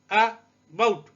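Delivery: About with a loud, punched bought; stress on second syllable. Shown as a demonstration. Teacher showing syllable stress, about stressed on bought. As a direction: keep the अ light, hit bought in about. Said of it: "bought जो है ये stress है".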